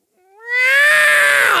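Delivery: high, loud cub roar; little cat roar. Acted. Preacher acts young Simba facing the hyenas, little cat roar. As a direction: squeal out a lion cub's attempted roar. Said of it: "It's like a little cat".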